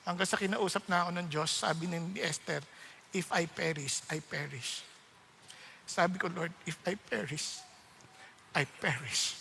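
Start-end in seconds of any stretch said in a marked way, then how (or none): 3.1-9.3 much softer and lower, solemn and slow; quoting Esther's vow in a hushed, low voice; solemn quoted prayer. Retold or imitated. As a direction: lay down your will in a hushed vow.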